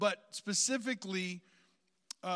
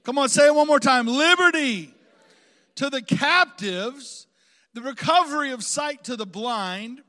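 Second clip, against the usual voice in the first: full-force preaching; 3 semitones higher, 13 dB louder than usual. Real, not acted. Preacher proclaims liberty to the congregation, full-force preaching. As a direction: declaim it to the whole room, stretching key words.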